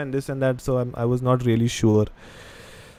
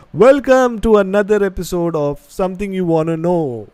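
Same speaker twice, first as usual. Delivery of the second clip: mock announcer intro, a touch higher and louder; long podcast intro; 5 semitones higher, 5 dB louder than usual. Shown as a demonstration. Host shows the clumsy full intro to viewers, announcer style. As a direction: belt out the full show intro like a presenter. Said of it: "how difficult it is, you know, to say".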